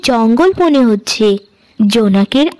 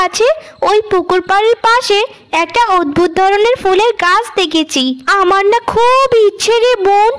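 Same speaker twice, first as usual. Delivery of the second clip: put-on a lightly higher, sugary older-sister voice; scheming elder sister; 8 semitones higher than usual. Elder sister coaxing Payel out at night, lightly higher and sugary. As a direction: sweet-talk the little sister into a trap.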